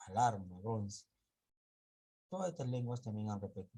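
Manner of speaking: usual speaking voice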